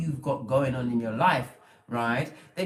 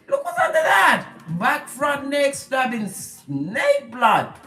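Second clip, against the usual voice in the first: excited shout; 7 semitones higher, 8 dB louder, slower than usual. Real, not acted. Pointing out backstabbing, shouting.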